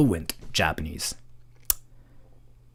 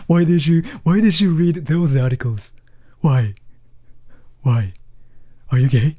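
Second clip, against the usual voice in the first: louder, higher, pushy questioning; mock accusing viewer; 4 semitones higher, 5 dB louder than usual. Imagined viewer grills the streamer, a mock accusing louder voice. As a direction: press hard, teasing.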